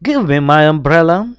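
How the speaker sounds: brisk command. A narrator's reading. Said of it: "says grandfather".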